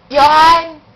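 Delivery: loud, drawn-out call of a name. Real, not acted. Calling the child by name. (malakas at pahabang pagtawag)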